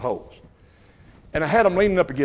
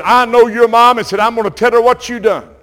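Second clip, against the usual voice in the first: loud, higher scolding man; loud, much higher stern reprimand; stern guard; 7 semitones higher, 9 dB louder than usual. Retold or imitated. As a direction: lay down the law to a young thief. Store guard scolding caught boy.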